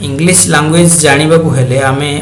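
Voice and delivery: ordinary delivery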